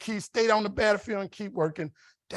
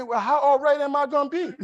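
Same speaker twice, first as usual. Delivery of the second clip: imitated wife, much higher and louder; sassy comic retort; 8 semitones higher, 5 dB louder than usual. Wife questioning husband about insurance after a crash, sassy retort. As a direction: fire back a high, loud punchline question.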